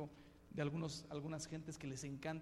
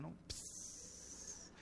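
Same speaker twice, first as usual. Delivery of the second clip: ordinary talk, nothing marked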